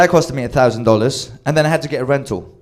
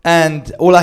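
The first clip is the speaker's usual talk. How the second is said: ordinary talk, nothing marked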